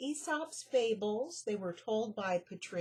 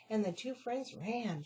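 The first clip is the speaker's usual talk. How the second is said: plain reading voice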